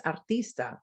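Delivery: artista said the Spanish way; Spanish pronunciation. Shown as a demonstration. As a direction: say artista with Spanish vowels and tapped r. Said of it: "words that are similar in spelling and meaning".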